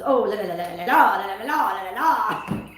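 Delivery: lightly imitating an exasperated French actor; mock frustrated Frenchman. Film character's frustrated la la la, lightly imitated. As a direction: huff the la las like an irritated Frenchman. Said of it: "his character got frustrated".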